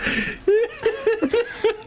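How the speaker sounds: high helpless laugh; real high giggling fit; real high laughter. Real, not acted. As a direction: keep laughing, pitch way up. Still laughing at the caller.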